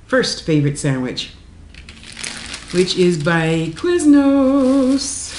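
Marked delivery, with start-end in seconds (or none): 2.7-5.3 announcer voice. Real, not acted. Announcing the brand name.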